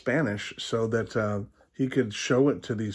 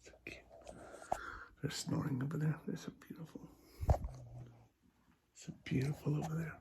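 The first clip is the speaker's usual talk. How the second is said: soft hushed whisper; 5 semitones higher, 18 dB quieter than usual. Real, not acted. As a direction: whisper so the dogs keep sleeping. Whispering beside sleeping dogs.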